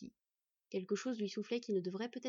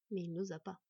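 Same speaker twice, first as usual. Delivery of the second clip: ordinary delivery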